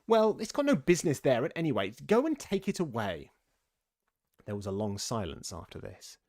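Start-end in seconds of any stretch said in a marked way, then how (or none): 0.1-3.3 high, loud dismissal; irritated, bossy rabbit; cross order. A narrator's reading. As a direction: order the servant off impatiently.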